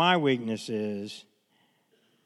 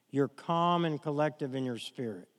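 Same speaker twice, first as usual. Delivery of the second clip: soft, sighing, much quieter calm; calm and collected; 3 semitones lower, 7 dB quieter than usual. Shown as a demonstration. Preacher models a calm spirit filled by the Holy Spirit, softly after a sigh. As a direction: breathe out and settle into calm. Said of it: "which means this".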